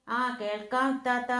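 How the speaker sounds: ordinary delivery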